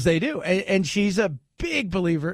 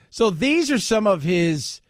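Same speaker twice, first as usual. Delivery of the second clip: ordinary talk, nothing marked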